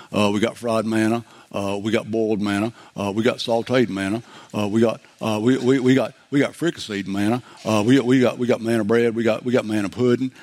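low-pitched deadpan menu recital; Bubba Gump character. Acted. Preacher parodying Bubba's list with manna dishes, low character voice. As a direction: list dishes one after another in a low, put-on voice. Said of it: "this is like Bubba Gump manna".

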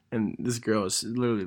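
ordinary talk, nothing marked

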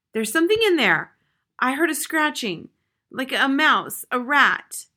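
high, loud, fearful insistence; alarmed outcry. A narrator's reading. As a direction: cry out in alarm, phrase by phrase. Alarmed invalid hears noise in wall.

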